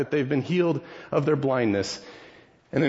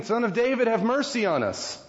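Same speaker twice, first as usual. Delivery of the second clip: high pleading call for mercy; blind men shouting; 8 semitones higher than usual. Acted. Blind men calling after Jesus, pitched high and pleading. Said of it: "they shout out again".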